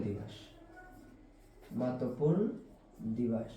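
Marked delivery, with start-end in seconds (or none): 1.7-3.5 1 slow dictation. Shown as a demonstration.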